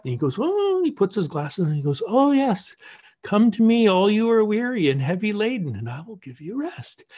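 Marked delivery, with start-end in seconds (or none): 0.4-0.8 high-pitched pondering "ohhh"; drawn-out "oh". Retold or imitated. Bishop asked to translate old Russian, high drawn-out oh. 2.1-7.0 high, lilting recognition, softening at the end; bishop reading aloud. Retold or imitated. Bishop translating the icon's words, high and lilting.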